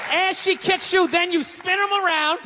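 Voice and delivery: ordinary talk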